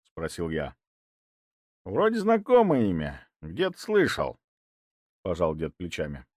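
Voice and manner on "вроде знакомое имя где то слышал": put-on old man voice. A narrator's reading. Grandfather musing.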